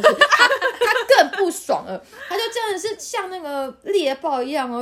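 slow, high laughing. Real, not acted. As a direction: laugh it out slowly. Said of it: "laughing while telling". (慢慢地笑着说，声音很高)

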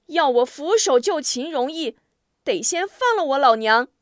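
firm, loud hostage bargaining; defiant demand. A narrator's reading. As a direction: call your terms out to the enemy. Hostage bargaining, hero shouts demand.

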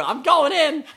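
high, loud laughing "I'm going in!"; teasing the character jumping in; mock heroic cry. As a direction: yell it like a brave fool diving in. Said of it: "You just go".

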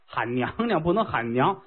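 normal talking voice

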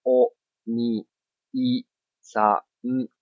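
いさん said very slowly; slow spelling out. Shown as a demonstration. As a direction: drag いさん out. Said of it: "是不是おに".

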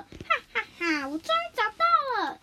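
high, elated cry of success; delighted eureka moment; triumphant discovery. Acted. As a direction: laugh out ha-ha, then pitch up in triumph. Mouse chief finds his answer.

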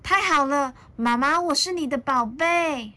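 put-on high, happy child voice; lifted, delighted storybook youngster; relieved little dinosaur. A narrator's reading. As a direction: exclaim joyfully to mother.

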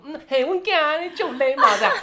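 high, loud, slow mock praise through laughter; laughing dad impression. Daughter mimics dad's smug approval, laughing. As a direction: laugh while playing the pleased dad.

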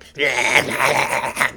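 loud, juddering goblin cackle to frighten someone; stuttering goblin screech; goblin scare noise. Acted. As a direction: make a loud taunting creature noise. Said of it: "making all these noises at her".

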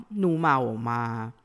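ordinary talk, nothing marked

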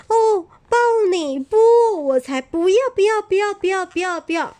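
high, loud repeated no that fades; emphatic refusal. Acted. Bear refusing the hedgehog, high loud no, fading away. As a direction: refuse over and over, loud then trailing off.

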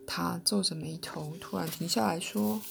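plain reading voice

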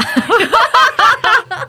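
loud, high laughter that falls off. Real, not acted. Guest laughing at the client's startled reply, high laugh. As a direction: burst out laughing.

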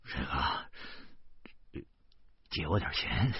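hushed, low, strained request; embarrassed, near-whispered plea; through clenched teeth. A narrator's reading. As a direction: squeeze it out reluctantly under the breath. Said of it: "便咬着后槽牙说".

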